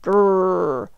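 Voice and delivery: drawn-out "grrr" growl; watchdog growl. A narrator's reading. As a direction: growl like a guard dog. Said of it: "the watchdog growled".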